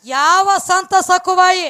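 high, slow, drawn-out storyteller's call; loud proclamation. A narrator's reading. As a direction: proclaim the saint's name loud and long. Storyteller proclaims the saint's name. (ಎತ್ತರದ ದನಿಯಲ್ಲಿ ಎಳೆದು ಸಾರುವುದು)

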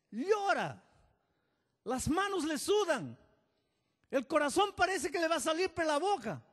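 high, loud pulpit cry; fiery preaching. Acted. Pastor preaching to congregation, loud fiery cry. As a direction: preach it out to the crowd, full force.

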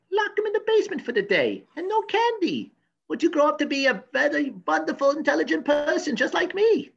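put-on very high-pitched German-accented mother; mother's voice. Professor quoting advice from mother, shrieky high German voice. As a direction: screech it up high like a cartoon mother. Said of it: "do what my mother did".

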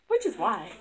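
ordinary talk, nothing marked

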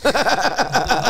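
short high-pitched burst of laughter. Real, not acted. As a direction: laugh at the friend's reaction. Teasing friend, cracking up.

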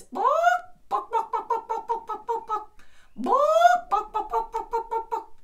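high loud bok bok clucks; chicken clucking. Shown as a demonstration. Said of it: "can you make a chicken noise?".

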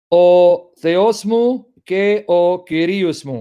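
slow "O Kyrios mu ke o Theos mu" with Greek vowels; Greek pronunciation. Shown as a demonstration. Reading John's Greek to students in careful Greek pronunciation. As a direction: draw out "Kyrios" the Greek way, word by word. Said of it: "Trying to pronounce like Greeks would".

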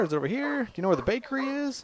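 high, quick rambling questions; man asking directions. Acted. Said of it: "He's like".

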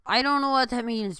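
exasperated outburst. Real, not acted. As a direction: blurt it out in disbelief. Baffled outburst at PewDiePie.

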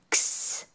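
whispered "X, x" as a breathy ks hiss; x sound. Shown as a demonstration. Teacher to children, a whispered "x" ks sound. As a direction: hiss "x" softly like ks. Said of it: "It makes the X sound".

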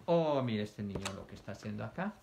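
normal talking voice

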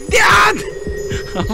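very high, long drawn shout; battle cry. Real, not acted. 敵をかわしながら気合の叫び. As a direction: hurl the cry out.

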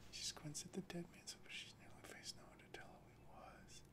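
soft whisper, almost gone; whispered reading. Acted. Reader whispering the eerie corpse scene, hushed. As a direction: read it under your breath.

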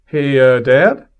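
loud call across the house to Dad. Retold or imitated. Teen calls his dad to the phone, a loud call-out. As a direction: raise your voice to reach Dad in another room.

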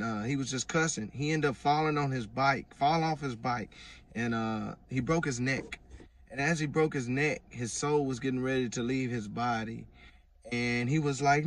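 ordinary talk, nothing marked

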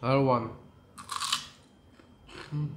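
chewing while speaking; talking between bites; mouth full. Real, not acted. Seen 1: Bites chicken at start, mouth full and chewing throughout.

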